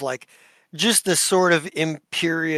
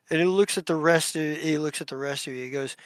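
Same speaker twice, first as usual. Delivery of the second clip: ordinary talk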